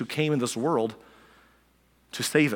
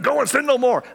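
high, louder finger-wagging rebuke; higher, louder preachy scolding; harsh religious scold; 11 semitones higher, 5 dB louder than usual. Retold or imitated. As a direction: throw the verse at her like a scold. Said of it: "the way that I picture some religious people".

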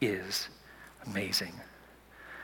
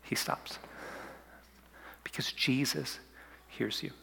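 whisper; 3 semitones lower, 16 dB quieter than usual. Acted.

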